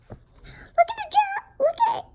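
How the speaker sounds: high fast giggly squeal; laughing. Real, not acted. Child laughing at the picture of Piggy's ear, high and giggly. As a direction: giggle through the words up high.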